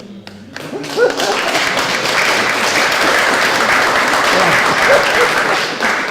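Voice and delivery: long high loud burst of laughter; laughing before handing over to his wife. Real, not acted. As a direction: let the laugh run on.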